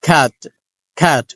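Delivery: খ্যাট with a breathy start and no puff at the end; aspirated k. Shown as a demonstration. Teacher shows aspirated start, plain end. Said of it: "শেষে টটাকে aspirated করছি না".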